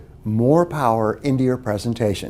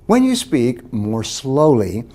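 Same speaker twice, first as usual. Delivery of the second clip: slowed down; slower than usual. Shown as a demonstration. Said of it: "Tip number one is to slow down".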